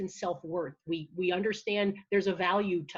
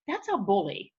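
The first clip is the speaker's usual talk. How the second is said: ordinary talk, nothing marked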